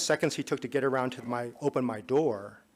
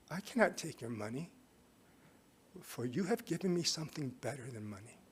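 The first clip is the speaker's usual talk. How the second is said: soft sincere refusal; 9 dB quieter than usual. Retold or imitated. Moved driver refusing money.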